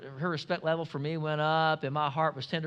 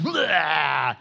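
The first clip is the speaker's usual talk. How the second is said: mock burst; 6 dB louder than usual. Filter bursting, stuff blows out.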